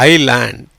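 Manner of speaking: ஐலேண்ட் with a silent S, low and falling; correct pronunciation. Shown as a demonstration. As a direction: say ஐலேண்ட் as "eye-land". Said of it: "எப்படி வருது?".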